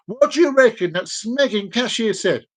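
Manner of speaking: usual speaking voice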